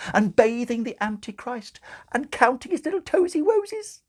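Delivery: put-on very high, cooing baby talk. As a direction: coo at the baby, sugary and high. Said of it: "said Sister Mary".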